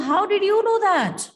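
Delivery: high, loud, startled demand; Jackson asking sharply. Acted. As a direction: fire the question at him in surprise. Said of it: "So then Jackson asks".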